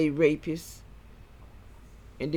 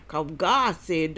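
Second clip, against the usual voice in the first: ordinary delivery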